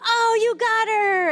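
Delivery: high, loud, slow cheer; mock excited cheer. Parent cheers child who finally reached mommy, loud and high. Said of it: "you're like".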